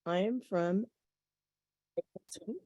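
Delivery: faint, breaking-up phone line; fading out on a bad connection; barely audible. Real, not acted. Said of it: "[name], can you speak up".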